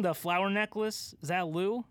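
ordinary delivery

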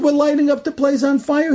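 high-pitched retold retort; arguing back. SS men answering the officer, high-pitched argumentative retort. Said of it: "they had a whole fight".

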